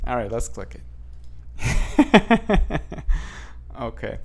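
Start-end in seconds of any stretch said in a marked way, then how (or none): 2.8-3.7 voiceless, wheezy snicker; snickering laugh. Real, not acted. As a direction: laugh quietly at yourself without voice. Gives in and clicks the link.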